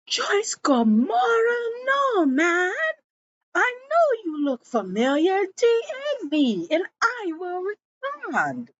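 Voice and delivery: very high, wailing crazy-lady voice. Retold or imitated. Crazy lady in jail claiming Joyce's name, high and loopy. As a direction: screech it high like a comic madwoman. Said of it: "And the crazy lady goes".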